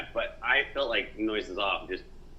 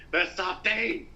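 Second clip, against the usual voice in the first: Michael Caine scream; 7 semitones higher, much slower than usual. Retold or imitated. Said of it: "hear Michael Caine scream".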